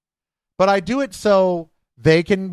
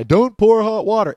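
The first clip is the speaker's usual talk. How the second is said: loud, higher mock scolding of a stranger; retold shouted order; 6 semitones higher, 5 dB louder than usual. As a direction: bark it back the way Eric yelled it. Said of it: "after I got shouted at by this Eric guy".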